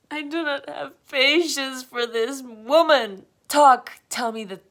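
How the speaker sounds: loud, high impatient rant; exasperated outburst. Acted. Fed-up listener to a stalling storyteller, loud exasperation. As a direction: demand it at the end of your patience.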